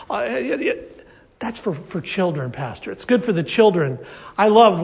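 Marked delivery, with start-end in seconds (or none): 0.1-2.9 low, soft mock grumbling; dismissive grumble. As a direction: brush it off as kids' stuff, quietly. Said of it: "with this attitude".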